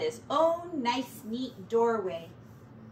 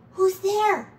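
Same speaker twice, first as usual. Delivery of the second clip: plain reading voice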